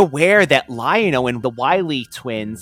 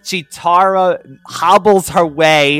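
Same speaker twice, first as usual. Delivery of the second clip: normal talking voice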